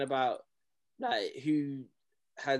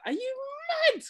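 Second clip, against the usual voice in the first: retold outraged disbelief, pitched way up; incredulous retort. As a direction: leap up high on a stunned question. Retelling disbelief at moderators hushing expert.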